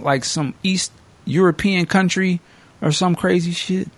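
ordinary talk

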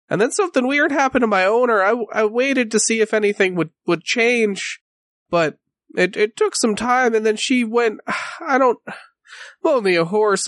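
lightly voiced horse, hesitant and troubled; a touch of a frightened young horse; shaken talking horse. Horse tells of the attack.

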